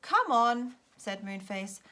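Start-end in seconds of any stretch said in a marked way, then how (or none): 0.0-0.8 loud cheerful call. Acted. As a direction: call them over loudly. Moonface calling children over.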